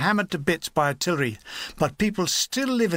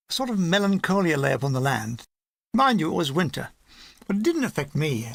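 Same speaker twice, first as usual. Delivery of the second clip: ordinary delivery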